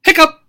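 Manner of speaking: loud high squeaky hiccup. Pretend. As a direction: pop out a sudden high hiccup. Teacher acts hippo hiccups.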